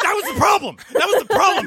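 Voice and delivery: high, laughing repeat; laughing agreement. Real, not acted.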